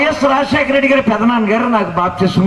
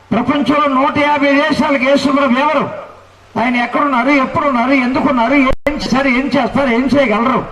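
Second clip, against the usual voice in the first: raised, rolling pulpit cadence; stacked lists of praise, mildly rising preacher voice; fervent preaching; 4 semitones higher than usual. Acted. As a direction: proclaim each line to the crowd. Preacher builds a proclamation.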